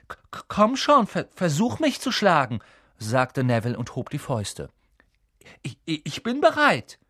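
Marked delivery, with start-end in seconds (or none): 0.1-2.6 high, nervous challenge; stammering dare. Acted. Neville raising his fists at friends, stammering defiantly. 5.6-7.0 high, nervous bravado; stammering readiness. Acted. Neville ready to fight his friends, stammering bravely.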